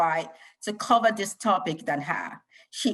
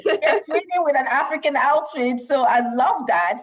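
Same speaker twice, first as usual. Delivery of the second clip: delighted; 7 semitones higher, 4 dB louder than usual. Real, not acted. Host delighted by matching outfits.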